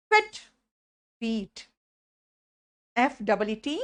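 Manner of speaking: usual speaking voice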